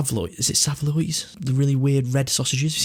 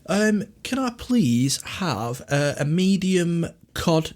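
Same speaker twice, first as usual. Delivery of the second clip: higher, slow, polite customer voice; ordering at counter; 5 semitones higher, much slower than usual. Pretend. Customer ordering cod and chips from the server, higher and slow. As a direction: ask politely for the order, drawn out. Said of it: "order it exactly as you would".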